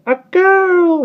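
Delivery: very high, loud bird shriek. Acted. Starling dancing on his toes, shrieking the news very high and loud. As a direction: shriek it out in joy. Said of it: "he shrieked".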